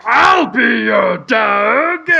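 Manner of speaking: slow, pleading cartoon crow; advert crow voice. Retelling the ad, slow pleading crow voice. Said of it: "And then the crow's like".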